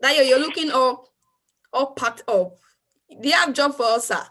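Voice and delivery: normal talking voice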